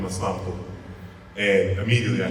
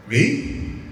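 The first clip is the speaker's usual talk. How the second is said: incredulous; 7 semitones higher than usual. Retold or imitated. Retold outraged disbelief.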